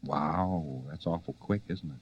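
low, soft, understated wow; mock amazement. Lecturer reacting to a student's quick lesson, mock amazement. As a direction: murmur it low, as if impressed.

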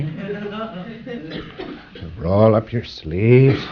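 mock strain. Lecturer to students, miming the strongman, slow hammed-up effort. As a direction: drag it out like lifting a fake weight.

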